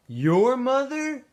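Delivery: high, slow mocking question; teasing. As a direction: drag it out to tease him. Squid teasing.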